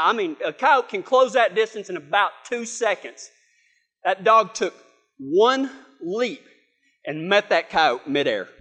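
ordinary talk

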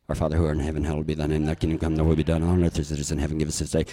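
low, rushed mumble of the Lord's Prayer; nervous golfer rushing a quiet prayer on the tee box; mumbled prayer. Retold or imitated. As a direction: gabble the prayer under your breath. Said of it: "and I'm like".

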